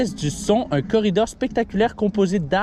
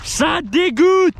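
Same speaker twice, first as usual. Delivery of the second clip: slow, high, loud mock disgust; hammed-up slow yell of disgust; drawn-out disgusted shout; 10 semitones higher, 5 dB louder, much slower than usual. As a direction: stretch out the outrage, hammed-up. Mock outrage repeated.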